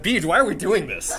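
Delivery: mock student protest. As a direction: complain loudly as the doubting audience. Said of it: "and you're like".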